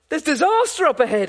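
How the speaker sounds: "There's disaster up ahead" called out high and loud; retold shout. Policeman warning drivers of the crash ahead, shouting. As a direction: yell the warning into the fog. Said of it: "he was shouting".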